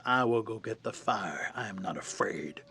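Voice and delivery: slow, hushed low voice; whispery, deliberate snake declaration; huge snake. Acted. Huge snake volunteers for the fire, slow hushed voice.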